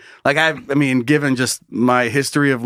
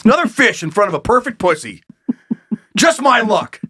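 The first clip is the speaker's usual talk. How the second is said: rising mock grumble, higher by the end; a hammed-up fisherman cursing his luck; imagined frustrated fisherman; 5 semitones higher than usual. Picturing the fisherman cursing his luck, mock frustrated. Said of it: "I picture this guy".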